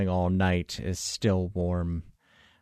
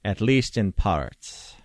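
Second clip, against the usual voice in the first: measured expert; 2 semitones lower than usual. Acted. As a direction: explain it formally, with careful pauses. Voices the expert on the Fae.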